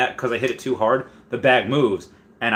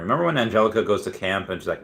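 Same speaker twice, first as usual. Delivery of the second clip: usual speaking voice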